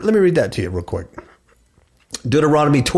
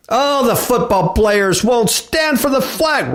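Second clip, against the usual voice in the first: high, sing-song mock complaint; mocking whine; 9 semitones higher than usual. Preacher mocks offended Americans, high sing-song. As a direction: sneer it like a whiny protester.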